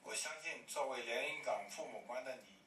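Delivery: normal talking voice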